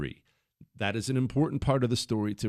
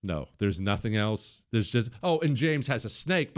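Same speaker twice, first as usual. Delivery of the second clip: ordinary talk, nothing marked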